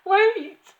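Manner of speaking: very high, loud yelp; squealed, desperate plea; panicked cry. A narrator's reading. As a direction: cry out like a flustered schoolboy. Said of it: "he cried".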